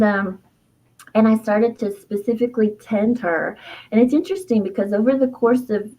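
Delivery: ordinary delivery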